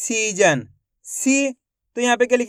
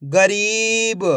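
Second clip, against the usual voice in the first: each syllable stretched out slowly; teacher slowly blends the syllables into a word for kids; slow blended word; much slower than usual. Shown as a demonstration. Teacher to young pupils, blending syllables: slow, drawn out. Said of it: "तो मिलके बन जाएगा".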